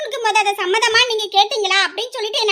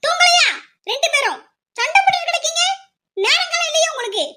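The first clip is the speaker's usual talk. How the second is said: higher-pitched scolding mother, nagging; a mother telling the girls off for staying up; 8 semitones higher than usual. Acted. Mother scolds daughters at night. (இரவில் மகள்களைத் திட்டும் அம்மா, உயர்ந்த குரலில்)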